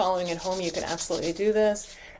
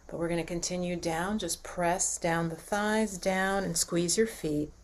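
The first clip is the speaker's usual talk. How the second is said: guided relaxation; 3 semitones lower, 5 dB quieter than usual. Acted. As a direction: gently talk them down through the body. Leads a body relaxation.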